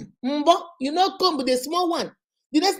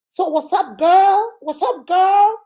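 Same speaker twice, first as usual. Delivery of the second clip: high, loud put-on American slang voice; hammed-up Chicago American accent; mock American accent; 6 semitones higher, 7 dB louder, slower than usual. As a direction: show off a fake American twang. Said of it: "you can speak American accent".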